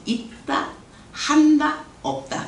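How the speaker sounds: 있다, 한다, 없다 as three beats, only 한다 hit; repeated title, spaced out. Shown as a demonstration. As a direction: pause between the three words, 한다 stressed. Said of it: "이렇게 하면 외우기 쉬울 것 같아요".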